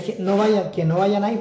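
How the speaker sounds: half-shouted plea, retelling the yell; retold desperate shout. Man retelling his dream, crying out to people: half-shouted plea. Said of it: "Y yo gritaba a todo lo que daba".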